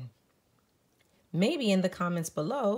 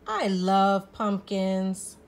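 slow, louder, heartfelt; loving; 3 semitones higher, 7 dB louder, slower than usual. A narrator's reading. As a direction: savour every word. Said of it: "says Spook".

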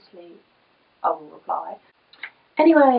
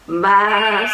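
louder baa; bleating sheep; 8 dB louder than usual. Acted. Parent voicing the sheep for her toddler, bleating. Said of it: "said the sheep".

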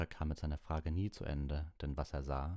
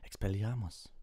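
higher-pitched cast of a spell; called-out spell; 6 semitones higher, 3 dB louder than usual. A narrator's reading. Lupin disarming the others, called out. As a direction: call the spell out sharply. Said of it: "rief Lupin".

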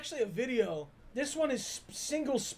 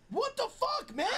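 high, exasperated disbelief; appalled outburst; 11 semitones higher than usual. Real, not acted. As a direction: cry out in shocked protest. Appalled at co-host's dark remark.

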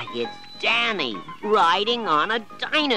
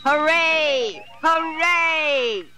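much higher, louder cheer; cheering children; 6 dB louder, much slower than usual. A narrator's reading. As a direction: cheer the winner. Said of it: "the children cried".

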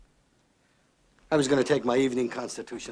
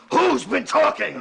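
slow, high, shouted demand; enraged boss roaring at his men; furious demand; 12 semitones higher, 6 dB louder, slower than usual. Acted. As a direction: bellow it, dragging out each word. Boss repeats the demand in rage.

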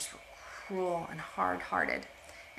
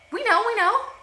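high, loud, panicky "we know"; flustered bluff; 11 dB louder than usual. Acted. Sisters pretending to the being's sister, panicky bluffing.

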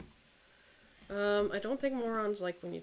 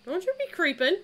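sassy taunt; 8 semitones higher, 6 dB louder than usual. Real, not acted. Taunting the ghost.

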